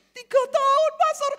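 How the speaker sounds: whiny youth. Retold or imitated. Youth answers, whining.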